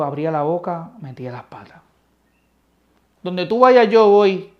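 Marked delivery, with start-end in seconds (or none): 3.2-4.5 higher, louder, proud promise; bold boast. Acted. Brash Peter promising to follow Christ anywhere, loud and high. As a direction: declare it like a brash disciple.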